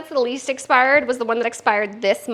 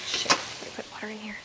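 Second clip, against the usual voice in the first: muttered curse under breath; whispered curse; 8 dB quieter than usual. Real, not acted. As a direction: whisper it to yourself.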